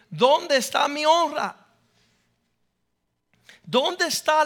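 loud, impassioned preaching that swells on key words; full-force preaching. Acted. Pastor preaching to the church at full force. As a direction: preach it out hard to the congregation.

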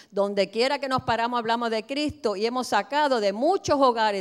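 normal talking voice

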